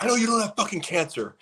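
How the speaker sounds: high-pitched retold outburst; mock accusation. Acted confrontation, retold accusation.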